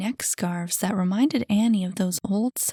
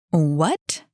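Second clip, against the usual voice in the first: incredulous, rising question; exclaimed surprise; 3 semitones lower, 5 dB louder than usual. A narrator's reading. Jackie hears she never heard of the club, exclaimed surprise. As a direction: blurt it out, louder and rising. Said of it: "she exclaimed".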